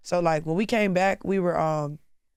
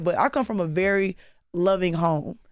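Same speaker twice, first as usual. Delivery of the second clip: ordinary talk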